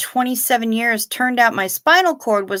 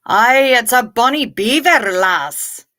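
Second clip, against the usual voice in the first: a bad, hammed-up Scottish accent, louder; a cheesy mock Scottish accent; 2 semitones higher, 5 dB louder, slower than usual. Joking with chat about the doctor's name in a bad Scottish accent. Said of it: "Her Scottish accent is much better than mine".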